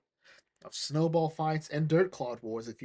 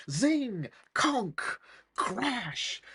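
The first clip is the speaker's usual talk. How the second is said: high, punchy cartoon hits; comic impact noises; 6 semitones higher, much slower than usual. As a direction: call out each blow like a sound effect. Imitates blows landing in the fight.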